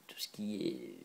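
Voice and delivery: "tout ce qui est" whispered low and soft, under the breath; muttered whisper to herself. Real, not acted. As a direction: drop to a whisper while hunting for the word. Whispered while searching for words.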